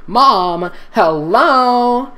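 high loud mock call. Girl calling for mom, high and loud. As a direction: shout into the empty house.

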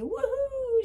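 very high, very loud woohoo; Leggy celebrating for Quill, high and loud; wild whoop. A narrator's reading. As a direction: cheer it out like you're dancing. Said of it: "She spun around madly".

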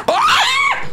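sudden high scream of fright; screaming in fear. Real, not acted. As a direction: shriek at the jump scare. Monster jump scare.

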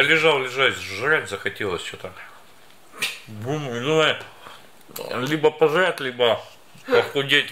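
speaking with food in mouth; biting bread while talking. Real, not acted. Seen 1: Bread raised from 2.7s, bitten 3.3-4.4s; meat only before.

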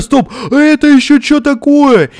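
sudden high, loud "wait, what's that?"; startled. Real, not acted. Spots a new monster craft.

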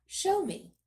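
commanding. A narrator's reading. King commands.

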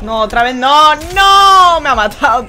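loud anguished shout; raging gamer. Real, not acted. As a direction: yell as you lose. Gets killed in game.

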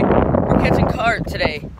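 ordinary delivery

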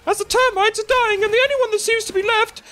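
over-the-top play-by-play for the Smurf village battle; announcer voice. Pretend. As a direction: call the bug fight like a hyped-up arena announcer. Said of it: "but in the announcer voice".

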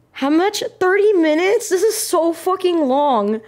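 high-pitched exasperated protest; dismayed disbelief. Real, not acted. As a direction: shoot up high, then drag out the complaint. Dismayed at the thirty-minute challenge.

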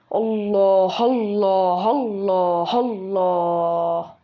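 very slow, stretched exclamations; drawn-out exasperation. Acted. Exasperated boy on a phone quarrel, long drawn-out exclamation. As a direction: drag out each word at length.

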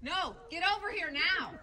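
urgent call. Real, not acted. Urging friend back from bear.

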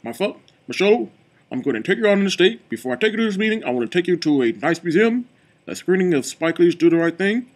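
playful mock-presidential voice with lifted phrase endings; Barack Obama. Shown as a demonstration. Reviewer playfully imitating the president inviting Michelle on a date, Obama impression. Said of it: "we all do impression of Barack Obama".

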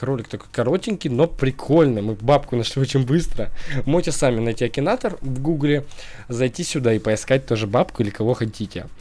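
ordinary delivery

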